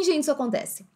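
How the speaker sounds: ordinary talk, nothing marked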